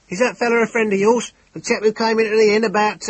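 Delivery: high, loud, frantic questioning; a frightened innkeeper blurting out; panicked innkeeper. Acted. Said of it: "overwhelming emotion".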